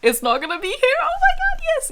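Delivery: high-pitched squeal of disbelief and joy; very excited. Real, not acted. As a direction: pitch shooting up in elation. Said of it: "I'm very excited".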